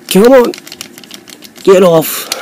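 frustrated "come on" while tugging; exasperated urging. Real, not acted. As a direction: urge it to come loose, voice jumping up. Struggling to pull a stuck part.

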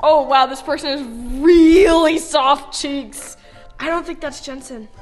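usual speaking voice

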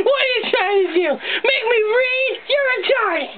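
normal talking voice

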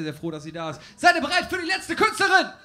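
ordinary talk

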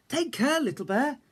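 Big Bear warning the cub on the stones; warning call. A narrator's reading. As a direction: warn the cub from the bank. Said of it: "said Big Bear".

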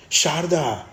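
high, loud falling cry; shouting. A narrator's reading. Brother cries out as his sister slips away, shouting. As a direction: call out her name desperately. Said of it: "જીગરે બૂમ પાડી".

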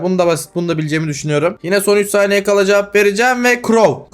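ordinary talk, nothing marked

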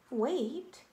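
ordinary delivery, nothing marked

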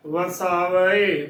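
ਵਸਾਵੇ slowly as "va-saa-va-e"; correct four-syllable reading. Shown as a demonstration. Teacher spelling out the word, ਵਸਾਵੇ as slow "va-saa-va-e". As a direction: stretch a separate final e on ਵਸਾਵੇ. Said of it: "ਈੜੀ ਨੂੰ ਲਾਵਾਂ ਏ".

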